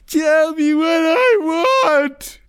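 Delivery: put-on piercing, drawn-out toddler wail; acted-out childish tantrum shriek; young child voice. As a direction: cry it out very high and slow like a small child. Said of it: "like, a, a young child".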